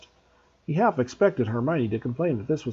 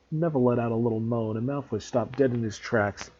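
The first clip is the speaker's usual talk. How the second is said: plain reading voice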